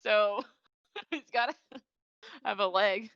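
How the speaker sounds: giggly, breaking into laughs mid-phrase; laughing through words. Real, not acted. Co-host joking about a character's leg, laughing. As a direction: talk through a bubbling giggle. Giggling while recapping the show.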